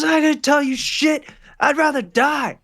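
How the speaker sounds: high, loud yell into the wind; defiant yell. Acted. Dangled thug yells defiance up at the gangsters over the wind. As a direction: shout it out, hanging upside down.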